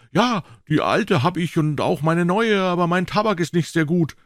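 boy pretending to be offhand; put-on nonchalance. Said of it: "so ganz nachlässig, als ob's gar nichts wär".

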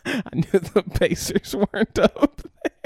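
laughing loudly through the words. Real, not acted. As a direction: laugh it off, caught out. Caught out guessing standings.

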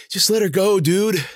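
high, winded complaint; groaning, breathing heavily. A narrator's reading. Tired man begging his friend to give up the chase, groaning. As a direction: groan it out between heavy breaths. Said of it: "Ben groaned. Breathing heavily".

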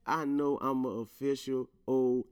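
ordinary talk

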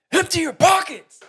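high, loud barked order from behind; retold yell. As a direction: bark it like an angry TSA guard. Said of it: "and he yells".

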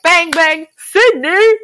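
high, loud sing-song shout. Retold or imitated. Billy's cry mashed into a pop star's stage shout. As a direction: belt it like a pop star swinging a club. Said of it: "[name] is, like, with her little golf club".

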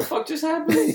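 high-pitched mock bewilderment; baffled reaction. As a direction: squeak it out, replaying total confusion. Acts out own baffled reaction.

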